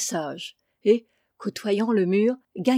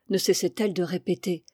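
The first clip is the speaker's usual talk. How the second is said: ordinary delivery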